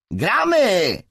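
high, loud shout; calling out. A narrator's reading. Player summons a boy back to the game, shouting. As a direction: yell to get the game going. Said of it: "zawołał Randy".

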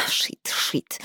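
whispered, under the breath; doctor cursing quietly to herself; whispered curse. Acted. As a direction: hiss it to yourself.

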